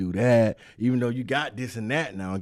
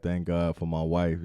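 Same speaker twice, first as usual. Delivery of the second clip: usual speaking voice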